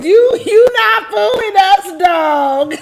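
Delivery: lightly voiced Tommy, high mock shout; playful Tommy callout, lightly done. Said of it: "In my Tommy voice".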